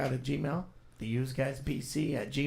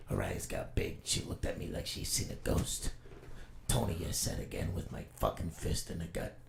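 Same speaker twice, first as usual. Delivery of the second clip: hushed, lowered Rorschach noir narration; 5 semitones lower than usual. Acted. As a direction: brood like a hardboiled vigilante narrating. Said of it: "Rorschach, take one".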